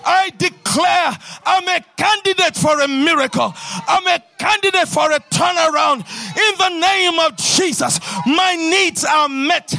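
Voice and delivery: rousing, declaiming sermon voice; preaching at full force. Acted. Preacher proclaiming blessings to the congregation, full-force preaching.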